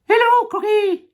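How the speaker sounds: very high, loud monkey squeal; Mugglewump. Acted. Monkey greeting the crocodile, very high and loud. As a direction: squeak a greeting at the top of your voice. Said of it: "said Mugglewump".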